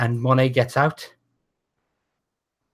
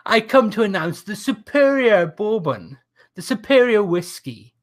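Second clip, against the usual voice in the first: 19th century orator; 6 semitones higher, 3 dB louder than usual. Acted. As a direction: hold court like a lordly showman. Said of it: "Lord Monet promo continues".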